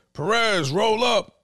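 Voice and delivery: retold shout down a cellblock; calling out. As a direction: call the name out like a jail roll call. Retold guard calling out inmate.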